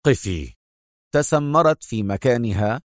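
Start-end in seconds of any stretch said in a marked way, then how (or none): 0.1-0.5 low, firm halt order; stern command. A narrator's reading. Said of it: "صاح بها أدهم في صرامة".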